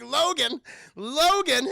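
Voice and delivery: calling out. Retold or imitated. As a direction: hail him by name, twice. Character calls out to Logan.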